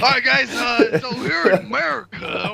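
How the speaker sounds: a light put-on American accent, slow and drawn; laughing through a mock American greeting. Podcast guest greeting the others in a mock American accent. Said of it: "I love it".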